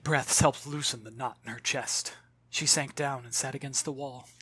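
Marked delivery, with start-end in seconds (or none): none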